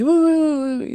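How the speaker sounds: squeaky high eager yeses; a hint of an overeager official; mock eager yes. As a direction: parrot him, high and eager. Quoting the director's eager reply.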